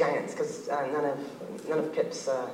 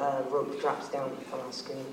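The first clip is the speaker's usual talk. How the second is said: ordinary delivery, nothing marked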